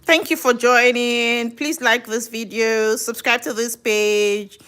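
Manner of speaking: slow, drawn-out presenter delivery; channel plug. Acted. Host asks viewers to like and subscribe, presenter voice. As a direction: stretch each phrase ending for the audience.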